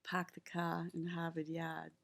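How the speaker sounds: dropped Boston R's, park the car in Harvard Yard; easy Boston accent, spoken softly. Shown as a demonstration. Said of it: "a term you would say with a hard accent".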